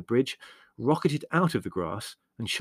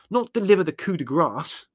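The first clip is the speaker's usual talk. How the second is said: high, loud, winded complaint; indignant, strained correction; panting; 8 semitones higher, 7 dB louder than usual. A narrator's reading. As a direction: point it out, exasperated.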